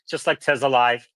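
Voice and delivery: ordinary delivery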